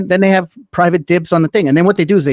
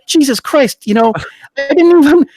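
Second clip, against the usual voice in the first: shocked disbelief; 11 semitones higher, 3 dB louder than usual. Retold or imitated. Retelling his shocked reaction.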